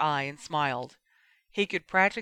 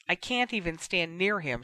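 plain reading voice

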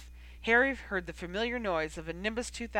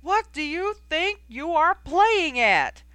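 much higher and louder, slow furious yell; furious shout; 10 semitones higher, 10 dB louder, slower than usual. A narrator's reading. Coach Oliver scolding Knight mid-match, furious slow shout. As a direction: tear into her from below.

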